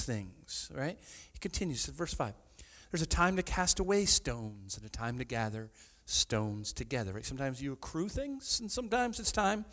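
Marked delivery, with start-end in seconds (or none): 2.9-7.1 low, measured reading voice; scripture reading. Real, not acted. Preacher reads Ecclesiastes aloud to the congregation, solemn. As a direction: read it solemnly from the page.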